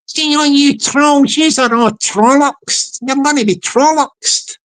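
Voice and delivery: lightly Scottish, slow "See all you trolls"; a hint of a funny Scottish guy scolding trolls; Scottish accent. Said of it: "funny Scottish guy talking like that".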